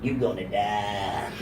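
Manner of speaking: low, quiet Louisiana drawl; hushed mock Louisiana seer; Louisiana psychic. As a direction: intone a slow Louisiana fortune-teller's prophecy. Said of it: "she'd be like a Louisiana psychic".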